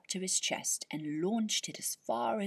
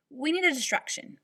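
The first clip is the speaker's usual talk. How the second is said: firm, loud announcement, higher; declared; 8 semitones higher, 6 dB louder than usual. Acted. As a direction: snap out of it and take charge. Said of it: "she declared".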